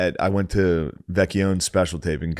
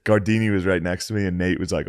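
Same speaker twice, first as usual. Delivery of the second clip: usual speaking voice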